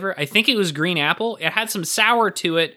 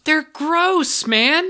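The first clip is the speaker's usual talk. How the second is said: disgusted protest; 11 semitones higher, much slower than usual. Real, not acted. Reacting to Tootsie Roll pick.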